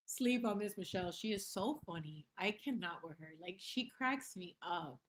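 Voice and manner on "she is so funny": usual speaking voice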